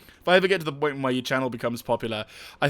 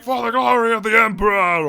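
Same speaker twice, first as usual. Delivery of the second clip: higher, louder mock soldier war cry; soldier battle cry; 8 semitones higher, 7 dB louder than usual. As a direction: imitate a game trooper rallying. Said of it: "shout voice quips".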